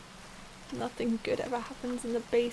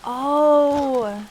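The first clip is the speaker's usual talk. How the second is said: startled oh; 10 dB louder than usual. Real, not acted. Dread at the basement.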